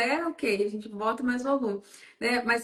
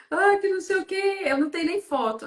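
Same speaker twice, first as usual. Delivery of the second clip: retold client's complaint, higher pitched; mock complaining; 7 semitones higher than usual. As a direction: imitate a fussy client's protest. Retelling client's self-conscious complaint.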